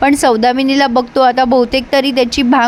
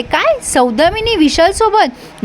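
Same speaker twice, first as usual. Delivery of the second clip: high, slow stunned gasp of a question; shocked disbelief; 6 semitones higher, slower than usual. Retold or imitated. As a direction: sound floored by the news. Gautami shocked at news.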